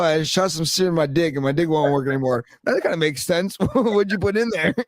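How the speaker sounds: ordinary delivery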